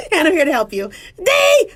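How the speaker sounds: laughing through the words, rising to a squeal; cracking up. Real, not acted. Doctor breaking out of her serious act, laughing. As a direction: lose composure into high laughter.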